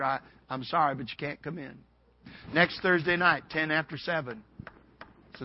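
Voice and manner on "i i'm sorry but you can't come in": soft, lower apology; very kindly. Retold or imitated. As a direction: turn him away gently and quietly. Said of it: "she very kindly told the preacher".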